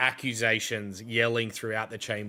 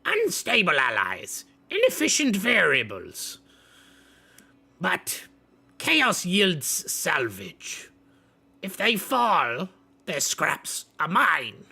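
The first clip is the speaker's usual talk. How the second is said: higher, loud, slow robotic voice; cold Thrax; 8 semitones higher, 7 dB louder than usual. Acted. Thrax coldly judges the allies, higher and mechanical. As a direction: weigh the allies like a machine. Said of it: "speaks in and goes".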